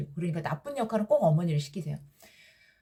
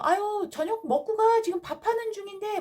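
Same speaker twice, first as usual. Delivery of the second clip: imitated friendly parent in a much higher voice; hospitable parent; 12 semitones higher than usual. Friend's parent inviting a child to stay for dinner, high-pitched hospitable imitation. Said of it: "그러면 대부분의 부모님들이요".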